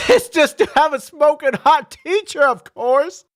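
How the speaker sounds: high, giddy aside; a cheeky high aside; laughing aside. Acted.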